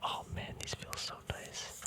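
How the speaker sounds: ordinary delivery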